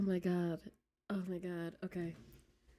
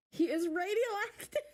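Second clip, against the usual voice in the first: much higher, louder gushing squeal; mock squeal; 11 semitones higher, 3 dB louder than usual. Streamer swooning over villain to chat, mock squeal.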